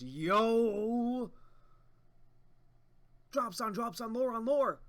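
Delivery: loud high excitement; hyped shout. Real, not acted. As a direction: celebrate the reveal. Hyped reaction to reveal.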